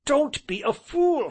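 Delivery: high, slow urgent cry; cried warning. Acted. Friend crying out to stop Sir Harry, urgent. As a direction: stop him hard. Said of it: "cried the other".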